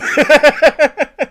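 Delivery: high burst of loud laughter. Real, not acted. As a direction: crack up loudly. Laughing at forgotten Dave.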